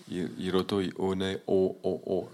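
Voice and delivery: "hiroto, one, o, o, o" broken by puffs of breath; breaths and breaks. Shown as a demonstration. Showing Tahitian breaths on "o, o, o", vowels cut apart. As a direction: separate each "o" with a catch of breath. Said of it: "les souffles comme ça".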